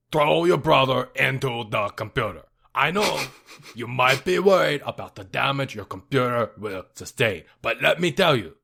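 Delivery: drifting put-on voice sliding toward a smoker rasp; host doing Strong Bad answering a fan. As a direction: play Strong Bad answering mail, accent slipping. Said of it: "leaning like a smoker voice".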